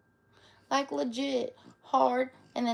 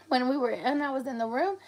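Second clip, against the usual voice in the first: normal talking voice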